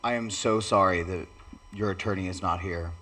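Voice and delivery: normal talking voice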